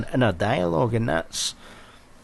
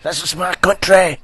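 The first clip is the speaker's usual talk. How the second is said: higher and louder hammed-up patriot; mock patriotic shout; 9 semitones higher, 7 dB louder than usual. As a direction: bellow it like a pub nationalist, in jest.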